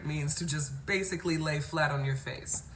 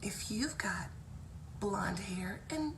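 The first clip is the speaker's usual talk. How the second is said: soft, high, breathy singsong whisper; imitating teacher; 3 semitones higher, 7 dB quieter, slower than usual. Teacher dismissing kids to snack, hushed high imitation. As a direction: call the children slowly in a hushed, high voice. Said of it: "So it would go like this".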